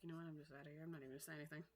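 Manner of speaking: huffy mutter. Retold or imitated. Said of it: "They're just like".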